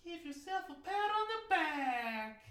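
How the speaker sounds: squeaky chipmunk-high voice; helium voice. Pretend. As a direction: talk like you sucked helium. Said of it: "is this the helium segment?".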